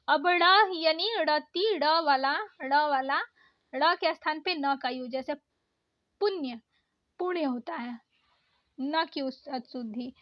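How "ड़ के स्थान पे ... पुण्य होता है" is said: ordinary talk, nothing marked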